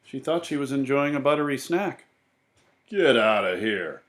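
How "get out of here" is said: moderately raised, commanding voice; man's shout. A narrator's reading. Man shoving Mercy away, mildly shouting. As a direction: order them off with a contained storybook shout. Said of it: "shouted the man".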